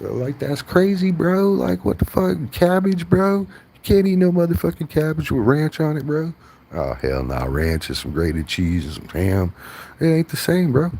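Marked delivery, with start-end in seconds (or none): 0.0-6.3 high, loud mock outrage voice; hammed-up shrill girl complaining about cabbage; mocking her rant. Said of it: "She left". 10.0-10.9 high, loud mocking voice; mock outrage. Mock voice continues her complaint.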